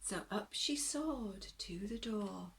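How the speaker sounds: gentle bedtime story reading, rising and falling; storybook narration. A narrator's reading. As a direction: read it like a picture book to little ones.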